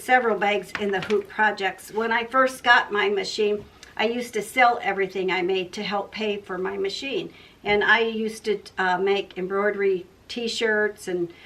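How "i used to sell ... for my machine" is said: ordinary delivery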